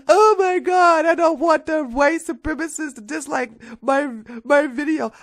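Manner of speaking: high, loud whiny voice mocking a worried conservative; whiny mock panic. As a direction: squeal it like a scared sellout.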